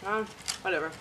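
talking while chewing; eating mid-sentence; mouth full. Real, not acted. Seen 0: Puts orange food piece in mouth at 1.0–1.5s, then chews.

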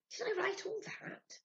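very high, slow amazement; amazed. A narrator's reading. Sylvia astonished at her writing, very high. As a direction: marvel at the page.

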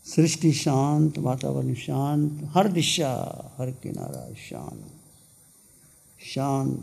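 calm, softened meditation voice with long pauses; guided meditation. Real, not acted. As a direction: guide listeners gently into stillness, letting phrases fade. (शांत स्वर में ध्यान का मार्गदर्शन)

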